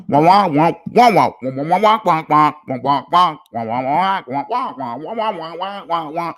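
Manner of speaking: wordless wah-wah grown-up babble; Charlie Brown teacher. Shown as a demonstration. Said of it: "Charlie Brown teacher voice".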